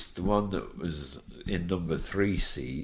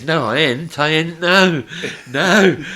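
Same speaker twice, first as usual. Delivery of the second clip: louder and higher, hammed-up insistent protest; mock loud protest; 10 semitones higher, 12 dB louder than usual. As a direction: playfully protest over and over. [name] loudly protests he wants girl.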